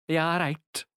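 put-on mixed Welsh and Scouse accent; a melting pot of accents. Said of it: "not sure if that was Welsh or Scouse".

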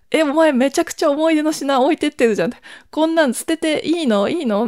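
can't believe she's tossing her keepsakes; incredulous older sister. Retold or imitated. The speaker to her sister, about the keepsakes left behind, disbelieving. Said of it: "みたいな感じで".

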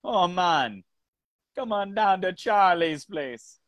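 high-pitched imitation of a bad Jamaican accent; high, hammed-up Jamaican accent; mock Jamaican accent. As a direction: mimic a muddled Jamaican lilt, pitched well up. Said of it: "kinda doing a Jamaican thing".

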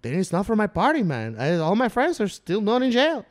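animated mock Mexican-accented rebuttal. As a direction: protest like the Mexican neighbor. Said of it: "like, an old Mexican man".

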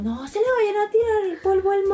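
grito agudo de advertencia; avísale alarmada, muy agudo. Retold or imitated. Amigos gritando advertencia al compañero. (advertencia aguda y alarmada)

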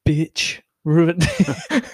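very slow, drawn-out delivery; hammed-up slow grievance; mock resentment. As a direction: drag out each word for comic effect.

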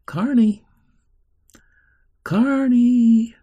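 high, loud call. Acted. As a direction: call her name to bring her back. Man calling her back.

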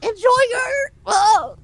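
high squawky cartoon duck voice; Donald Duck voice. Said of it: "your Donald Duck voice".

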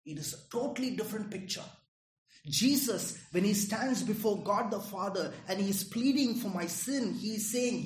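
ordinary delivery

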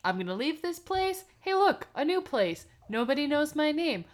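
high, put-on storybook character voice; lightly voiced Joringel. Host paraphrases Joringel's thoughts, high mock character voice. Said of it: "he's like".

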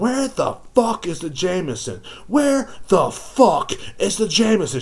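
high-pitched, slow, demanding yell; imitated scream. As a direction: screech it like an obnoxious customer. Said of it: "She said just like that".

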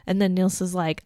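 ordinary talk, nothing marked

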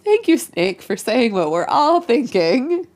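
high, loud, slow mocking gratitude; sarcastic thanks. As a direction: drip sarcasm while thanking him.

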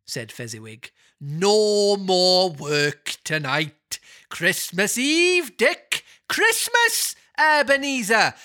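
very slow, high, booming Welsh calls; a jolly Welsh master shouting merrily; jovial Welsh Fezziwig. Acted. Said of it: "oily, rich, fat, jovial Welshman".

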